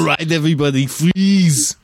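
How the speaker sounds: put-on loud, high Austrian-accented movie command; Arnold Schwarzenegger. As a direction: shout the one-liner, stretching the last word. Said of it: "five different Arnold movies now".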